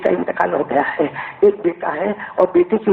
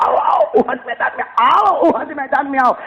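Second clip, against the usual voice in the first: higher, carrying call to gather; rousing call to battle; 6 semitones higher than usual. Retold or imitated. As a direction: summon everyone to the field. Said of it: "ہمارے نبی نے زوردار تشکیل کی".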